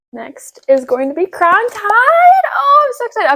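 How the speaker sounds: high, slow and gushing with real excitement; excited. Real, not acted. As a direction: squeal the title like it's your favourite series. Announcing a favourite upcoming book.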